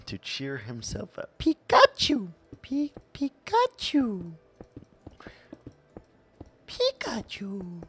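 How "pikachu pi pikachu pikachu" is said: high, squeaky creature cry; Pikachu. Acted. Streamer repeating the name to cheer up, high creature voice. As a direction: chirp it in a much higher put-on voice. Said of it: "saying Pikachu over and over to yourself".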